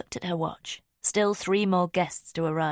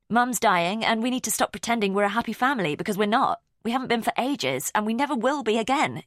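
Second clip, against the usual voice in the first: raised, heated voice, higher and louder, spilling out fast; angry outburst; 6 semitones higher, 4 dB louder than usual. Acted. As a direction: hurl it at him like an accusation. Teen daughter lashing out at father.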